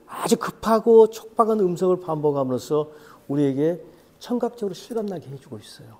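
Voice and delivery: ordinary talk, nothing marked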